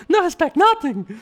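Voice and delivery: very high-pitched impression; Anderson Silva. Streamer showing viewers his favorite impression, high put-on voice. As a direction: do a comically high falsetto impression. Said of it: "I like the Anderson Silva one".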